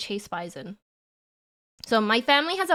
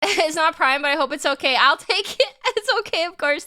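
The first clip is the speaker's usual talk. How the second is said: laughing; 6 semitones higher, 5 dB louder than usual. Real, not acted. Laughing over gifted subs.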